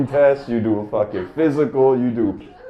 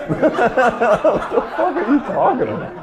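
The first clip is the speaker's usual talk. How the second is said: much higher, slow, laughing through it; laughing disbelief; 14 semitones higher, slower than usual. Real, not acted. Mocking the firefighters' logic, slow and laughing high. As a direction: drag it out, cracking up.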